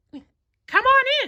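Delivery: very high falsetto, slow and welcoming; happy Mickey. A narrator's reading. Mickey welcoming his guests, high and happy. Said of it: "said Mickey happily".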